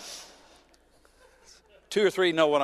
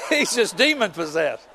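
laughing; 5 semitones higher, 5 dB louder than usual. Real, not acted. Laughing while telling story.

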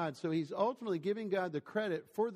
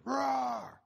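loud, high mock roar shouted at a lion; audience roar; 10 semitones higher, 4 dB louder than usual. Zoo visitor taunting the lion, loud high shouted roar. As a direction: bellow a roar to provoke the lion. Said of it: "somebody in the audience going".

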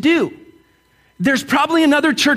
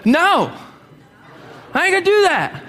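high, firm refusal like you mean it; emphatic no; 8 semitones higher than usual. Shown as a demonstration. Pastor demonstrates a firm no to congregation, emphatic. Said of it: "Now tell 'em like you mean it".

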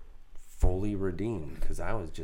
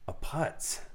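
self-reproach; 2 semitones higher, 7 dB quieter, much slower than usual. Retold or imitated. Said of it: "And I was like".

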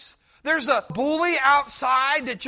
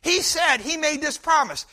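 ordinary delivery

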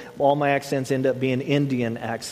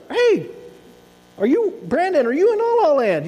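much higher, bright sing-song call; sweet teacher call-out; 11 semitones higher, 3 dB louder than usual. Retold or imitated. Teacher calling out to daydreaming Brandon, high sing-song. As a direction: call his name like a cheerful teacher. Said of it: "You know, just".

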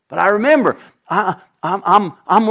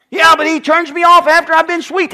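complaining wife; 10 semitones higher, 5 dB louder than usual. Acted.

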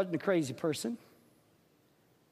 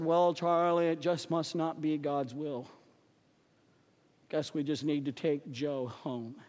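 lower, softer gloomy Eeyore voice; a defeated friend giving up, lower and softer; 9 semitones lower, 4 dB quieter than usual. Retold or imitated. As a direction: sigh it out like a mopey donkey. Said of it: "probably the Eeyore of the bunch".

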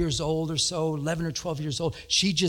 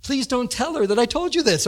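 normal talking voice

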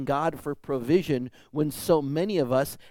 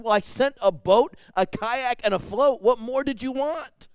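high, louder, matter-of-fact punchline; God, exasperated; 11 semitones higher, 7 dB louder than usual. Retold or imitated. God answering the drowned man, exasperated punchline. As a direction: deliver it like a fed-up God. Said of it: "And God said".

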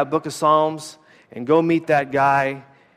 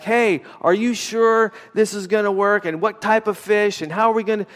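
mock skeptical disciples, pitched higher; doubting disciple; 7 semitones higher than usual. Preacher voicing imagined disciples doubting Jesus, pitched-up skeptical. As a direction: play a disbelieving fisherman calling back. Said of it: "Those disciples didn't yell back".

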